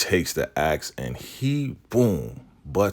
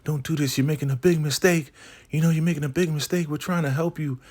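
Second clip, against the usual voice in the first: high-pitched, increasingly rushed mock pleading; imitated begging; 12 semitones higher, faster than usual. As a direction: beg urgently in a put-on high woman's voice. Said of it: "And she's begging".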